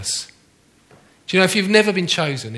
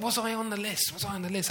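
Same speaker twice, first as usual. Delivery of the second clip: anxious hushed; 4 semitones higher, 11 dB quieter than usual. Retold or imitated.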